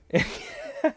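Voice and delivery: squeaky high burst of laughter. Real, not acted. Laughing at his own freak-out story, a high squeaky laugh. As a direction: crack up at the memory.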